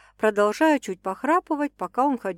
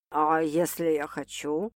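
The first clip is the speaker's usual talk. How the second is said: slow, soft and sly; 5 semitones lower, 4 dB quieter than usual. Acted. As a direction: tease him slyly. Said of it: "лукаво уточнил мужчина".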